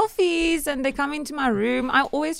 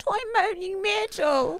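Moaning Myrtle voice; 8 semitones higher, 6 dB quieter than usual. Acted. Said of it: "I can do that".

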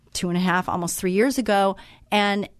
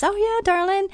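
much higher, sing-song gushing darling; sweet friend voice; 12 semitones higher than usual. Retold or imitated. Wendy answering the narrator's call, much higher gushing voice. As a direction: gush like a kind friend picking up the phone.

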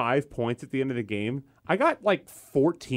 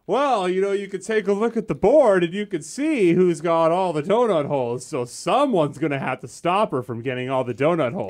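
loud, raised-pitch mock speech; speech-giving player; 5 semitones higher, 5 dB louder than usual. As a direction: imitate a player loudly lecturing the table. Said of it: "he gives the speech".

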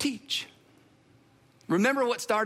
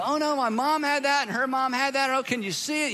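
higher-pitched put-on alarm; hammed-up, pitched-up worry; mock worried patient; 5 semitones higher than usual. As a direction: play a lightly panicked patient hearing bad news. Acted patient hearing a diagnosis.

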